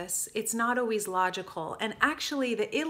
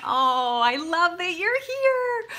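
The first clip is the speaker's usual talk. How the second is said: high, slow, loud gushing; 9 semitones higher, 9 dB louder, slower than usual. Real, not acted. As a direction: beam at her, slow and loud. Greeting a first-time viewer.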